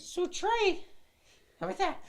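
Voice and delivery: very high squeaky gnome voice. Shown as a demonstration. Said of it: "Try it out".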